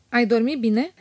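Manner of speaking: plain reading voice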